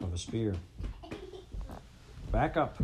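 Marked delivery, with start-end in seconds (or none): 2.3-2.8 high, loud order; fierce guard. A narrator's reading. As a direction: bark at them to back off. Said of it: "a fierce rabbit".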